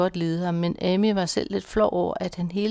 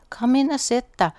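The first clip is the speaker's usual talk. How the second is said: calling out from the sofa, higher and louder; called out; 7 semitones higher, 5 dB louder than usual. A narrator's reading. Husband calling from the sofa to Signe, called out. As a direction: call him in warmly from the sofa. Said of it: "råbte han fra sofaen".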